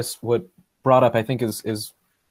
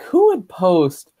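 incredulous, rising; 5 semitones higher, 4 dB louder, slower than usual. Retold or imitated. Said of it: "I was just like".

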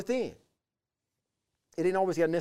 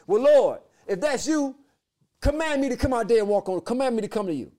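bold, brash, higher and louder Peter; a cocky fisherman daring his Lord; brassy Peter; 7 semitones higher, 7 dB louder than usual. Retold or imitated. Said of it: "with his old brass self".

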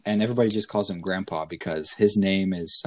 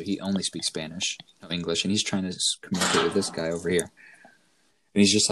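ordinary talk, nothing marked

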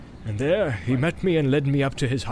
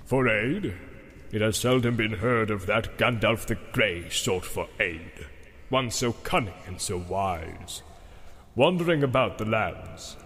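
scornful Saruman; 3 semitones lower, 4 dB quieter than usual. A narrator's reading. Saruman voiced by narrator.

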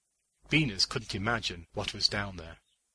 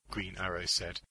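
ordinary delivery, nothing marked

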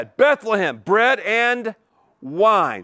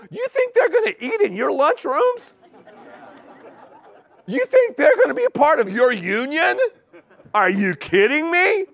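high-pitched, rising disbelief; incredulous challenge; 7 semitones higher than usual. Real, not acted. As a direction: scoff at the crowd's gullibility. Scornful rhetorical questions to the congregation.

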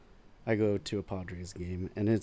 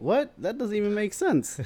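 confused; 12 semitones higher than usual. Retold or imitated. His confused reply quoted.

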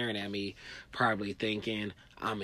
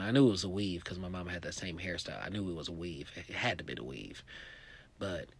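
ordinary talk

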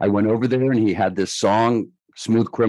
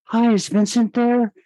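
much higher put-on voice of the caller; a shy superstar caller voiced far higher; 13 semitones higher than usual. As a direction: pitch way up to play the famous caller on the phone. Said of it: "this voice goes".

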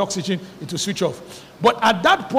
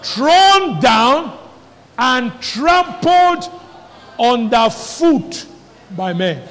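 slow, higher, stretched words; dramatic scripture reading; 4 semitones higher, much slower than usual. Acted. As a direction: paint the trampling, phrase by phrase.

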